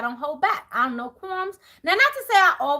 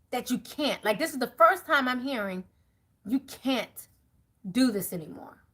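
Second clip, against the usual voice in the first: quiet quoted partner; 5 semitones lower, 3 dB quieter than usual. Retold or imitated.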